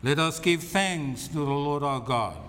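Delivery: usual speaking voice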